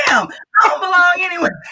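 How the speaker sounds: high, crying-laugh wail; mock wail about belonging; mock despair. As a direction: ham up being lost. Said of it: "mock despair".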